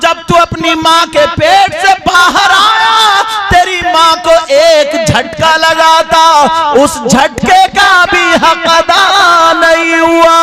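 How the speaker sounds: loud, slow, swelling sermon voice; impassioned pulpit delivery; full-force preaching. Acted. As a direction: build to the Prophet's verdict. Preacher recounts the Prophet's answer.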